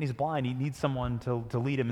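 normal talking voice

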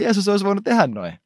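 ordinary talk

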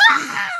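very high imitated group squeal; mock scream. Imitating friends finally cracking up, mock high scream. Everyone's reaction acted out.

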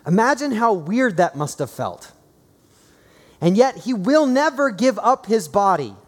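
high, loud, driving sermon delivery; impassioned preaching. Real, not acted. Preacher telling congregation God made them good, impassioned. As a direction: proclaim it to the whole congregation with force.

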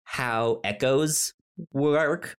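ordinary talk

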